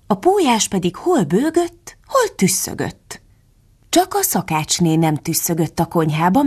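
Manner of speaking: ordinary delivery, nothing marked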